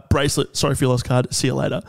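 normal talking voice

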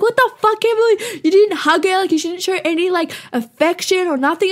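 imitating housemates telling her off; berating housemates. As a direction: scold her like outraged housemates. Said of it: "They're like".

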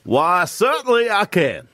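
slow Texas drawl; a Texan twang, taken slowly; Texas accent. Pretend. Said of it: "give us your, uh, your Texas accent".

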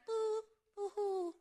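high, slow mock boo-hoo; dainty fake sobbing, drawn out; cute little crier. Mocking the cute little criers with a dainty boo-hoo. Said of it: "cute little criers".

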